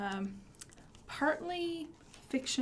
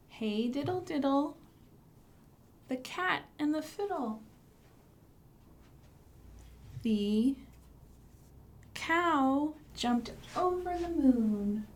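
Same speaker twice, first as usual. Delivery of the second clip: sing-song nursery rhyme; 3 semitones higher than usual. Acted. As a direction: lead the kids through the rhyme. Recites rhyme with children.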